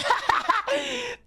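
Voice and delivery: very high real cackle of laughter; breaking into laughter. Real, not acted. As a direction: crack up mid-word. Breaks up while reading chat.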